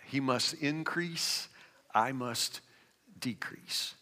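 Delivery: a hush that dies into a whisper. Acted. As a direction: let it fade away to nothing. Preacher drops to a hush.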